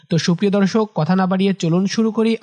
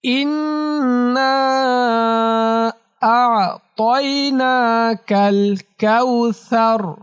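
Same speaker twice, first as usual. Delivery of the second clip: slow melodic chant, long vowels held; slow tajweed recitation drill; Quran recitation; 4 semitones higher, much slower than usual. Shown as a demonstration. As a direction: chant each phrase for pupils to repeat. Recites the full verse.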